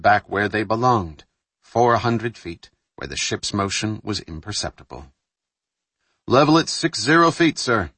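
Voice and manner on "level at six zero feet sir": higher crisp report; crisp naval report. Acted. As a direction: report depth sharply.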